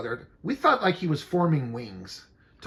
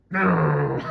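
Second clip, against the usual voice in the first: mock shouted no; 11 semitones higher, 4 dB louder than usual. Shouted mock scream laugh.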